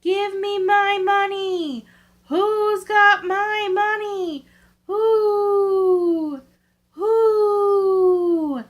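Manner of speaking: high, slow, crying ghost voice; dead woman's ghost wailing, rising to loud cries of "Who?". A narrator's reading. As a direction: wail from far off for her lost money. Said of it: "a voice cried".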